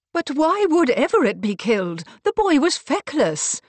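loud, high-pitched bewilderment; incredulous protest. Acted. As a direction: protest as if it makes no sense. Bewildered protest at news of murder.

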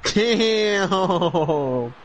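high, stretched-out amazed cry; drawn-out exclamation. Real, not acted. Exclaiming at a player's move.

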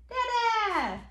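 high Dada sliding down; playful drawn-out high cheer; exclaimed refrain. Acted. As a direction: sing it out high for baby. Parent cheers book's refrain to child.

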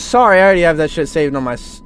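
usual speaking voice

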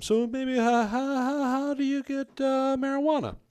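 high, timid squeaky voice asking; mock high-pitched nervous ask; cast member's question. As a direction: play the nervous naive tourist. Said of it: "one of the other cast members asked him".

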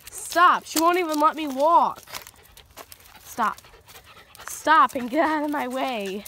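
loud, annoyed commands; exasperated scolding. Real, not acted. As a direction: order the dog firmly out of the way. Dog blocks the speaker's way.

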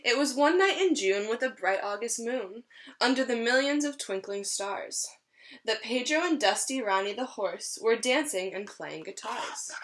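lively storybook read-aloud; reading to children. Acted. Teacher reading a rhyming story to her class, lively. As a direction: read it like a picture book for a class. Reads picture book to children.

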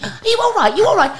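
worried caller. Retold or imitated. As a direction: ask it like an anxious caller on the phone. Caller hears her fall.

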